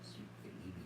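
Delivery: soft tender whisper. Real, not acted. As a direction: whisper sweetly to the pig. Soothing pig in whisper.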